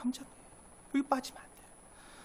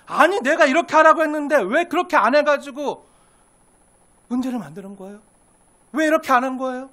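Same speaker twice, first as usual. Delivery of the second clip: loud, higher-pitched acted reproach; indignant scolding; 4 semitones higher, 9 dB louder than usual. Preacher playing a bossy believer scolding others, indignant. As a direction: demand an explanation, acting fed up. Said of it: "내가 하라는 대로 안 하면요".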